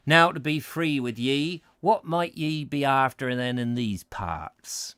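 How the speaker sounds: a put-on rustic West Country accent, slowed; old Devon pedlar, West Country accent, lightly done.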